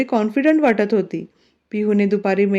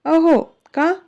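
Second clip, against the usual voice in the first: shocked; 6 semitones higher, slower than usual. A narrator's reading. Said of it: "Piyu shock होत त्याच्याकडे बघते".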